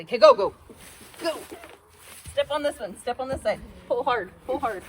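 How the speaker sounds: loud, urgent commands; urgent coaching shout. Real, not acted. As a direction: push her to finish it now. Urging during the kill.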